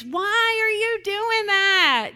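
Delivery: loud, high, drawn-out whine; mock a helpless mother's whine; whining parent. Parent asks kids why they did it, whining. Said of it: "look at Mom whine".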